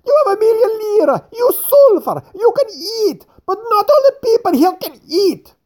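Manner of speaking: angry fat man. A narrator's reading. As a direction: lash out at the rich old man. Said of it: "now became quite angry".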